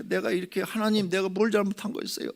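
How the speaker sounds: high, loud complaining cry to God; resentful prayer. Retold or imitated. Crippled unionist blaming God, high resentful complaint. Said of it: "원망의 기도를 했대요".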